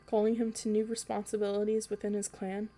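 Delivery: ordinary delivery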